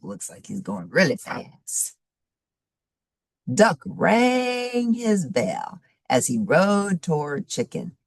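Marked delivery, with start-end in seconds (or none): none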